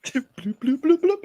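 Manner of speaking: high-pitched imitated bloops; bubbling noise. Teasing the actor leaving for his planet, bubbling noise. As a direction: bubble like sinking into water. Imitating water sound after Waterworld joke.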